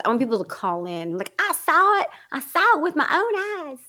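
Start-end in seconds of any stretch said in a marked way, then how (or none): 1.4-3.8 mock a diehard Southern believer; Southern believer accent. Caller mocking a diehard believer, Southern accent. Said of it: "with that accent".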